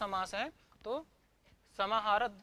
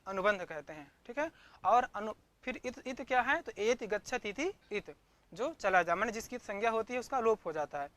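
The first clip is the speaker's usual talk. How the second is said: ordinary talk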